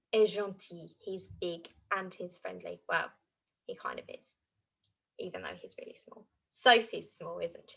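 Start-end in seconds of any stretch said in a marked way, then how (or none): 3.7-6.2 whispered aside. Real, not acted. Aside to viewers.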